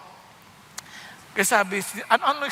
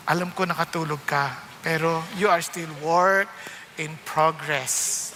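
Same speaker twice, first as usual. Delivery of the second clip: slow teasing; 6 semitones lower, slower than usual. Shown as a demonstration. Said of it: "Pakisabi sa tabi mo".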